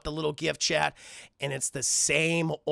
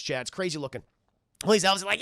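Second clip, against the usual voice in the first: normal talking voice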